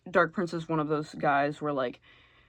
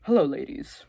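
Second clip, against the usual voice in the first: smug charmer; 8 dB louder than usual. Retold or imitated. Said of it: "he's just like".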